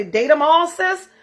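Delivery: high, punchy sassy call; playful hype for the girls; sassy catchphrase. Retold or imitated. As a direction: toss it off like a girlfriend's advice. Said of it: "when I say".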